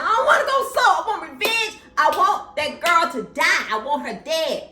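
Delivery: high, loud demand for revenge; high loud vengeful yelling; furious shout. Retold or imitated. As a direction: scream it like a spoiled furious king. Aegon voiced demanding revenge.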